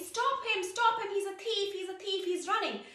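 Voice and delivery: high, loud urgent cry; shouting after thief. Acted. Men chasing the thief calling out, urgent shout. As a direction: yell to catch the thief.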